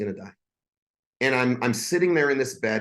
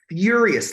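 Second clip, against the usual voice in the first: furious punched high and loud; 7 semitones higher, 6 dB louder than usual. Real, not acted. Telling the host how angry he was, furious stressed loud. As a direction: hit the word furious hard, raised. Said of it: "I was so".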